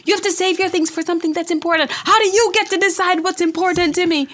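fast, loud, high-pitched quarrel; shouting match. Retold or imitated. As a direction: yell it like mid-argument with your daughter. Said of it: "we have these big shouting matches".

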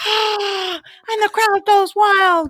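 high, airy fake roar of a crowd; mock crowd cheer. Host celebrating their twentieth episode, mock crowd cheer. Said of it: "This is when you go".

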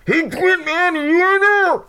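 high, loud muffled call; muffled shouting. Acted. Man banging on a car calls for Quint, muffled yell. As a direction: yell it as if from behind a barrier. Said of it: "you hear muffled, kind of like".

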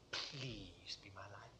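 hushed, drawn-out, near-whispered prayer; weak plea. Acted. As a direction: beg slowly and very low, unsure. On his knees, weakly pleading.

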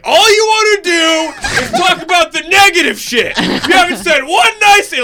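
high, full-throated outraged shout; imitated furious dad. Dad at parent-teacher conference defending his child, imitated angry shouting. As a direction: flip out at the teacher, hammed-up yelling. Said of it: "my dad flipped out on my third grade teacher".

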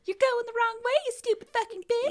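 high, loud mocking yell; recalling the angry lady's yell, imitated; mock furious lady. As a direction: screech the insult like the Walmart lady. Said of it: "she said".

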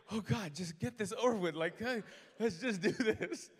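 loud, laughing, slowed plea; laughing exasperation. Retold or imitated. Pastor stuck in a prayer, laughing loudly. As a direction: laugh it off, begging it to end.

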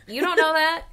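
high, loud indignant squeal; mock indignant. Real, not acted. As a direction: squeal it in outrage.

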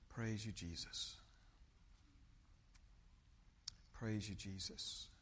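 soft, low murmured praise; low, reverent worship voice; hushed prayer. Real, not acted. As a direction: pray quietly with long pauses. Hushed worship prayer after sermon point.

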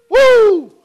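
high, loud celebratory whoop; excited whoop. Real, not acted. Preacher whooping to the congregation, high and loud. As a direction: let out a joyful woo.

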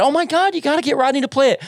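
high-pitched gushing fan quote; imitated excitement. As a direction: squeal it quickly, playing someone thrilled. Acts out assistant's excited reaction.

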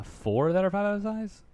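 high-pitched, drawn-out disbelief; stretched, high incredulous echo; incredulous question. Real, not acted. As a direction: question it slowly, pitch jumping up. Challenged on his count of fives.

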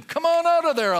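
high, loud call into the tomb; commanding call. Retold or imitated. Jesus calling into the tomb, loud command. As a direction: call Lazarus out, ringing. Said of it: "Jesus could say to death".

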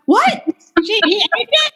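high, loud squeal of disbelief. Retold or imitated. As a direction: play the mom's shocked double take. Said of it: "she's, like, freaking out".

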